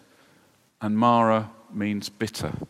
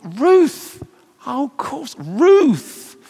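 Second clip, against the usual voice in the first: high, loud mock realization; suddenly remember her name, delighted; surprised realization; 14 semitones higher, 7 dB louder, much slower than usual.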